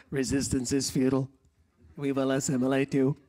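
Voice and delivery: storyteller imitating the Borg, mock robotic. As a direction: do a playful Star Trek Borg line. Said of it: "so you know about the Borg".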